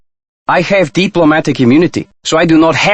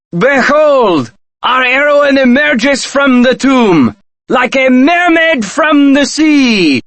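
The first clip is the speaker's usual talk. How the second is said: higher, slow, booming theatrical announcement; mock grand proclamation; 9 semitones higher, slower than usual. Teasing Nancy as she climbs out of the tomb, theatrical slow proclamation. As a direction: hail her like a herald, hamming up every word.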